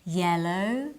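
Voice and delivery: Yellow starting like yes; Y sound. Shown as a demonstration. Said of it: "Repeat with me".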